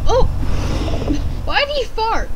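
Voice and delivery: high surprised disbelief. Real, not acted. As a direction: squeak it out in disbelief. Reacting to a farting character.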